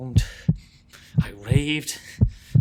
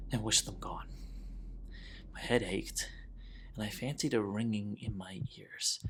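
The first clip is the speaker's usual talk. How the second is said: softer and softer, as if the officers might hear; hushed narration; 11 dB quieter than usual. Acted. Killer confessing the ringing in his ears, hushed whisper. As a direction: drop to a near whisper and stay there. Tension rises as officers sit.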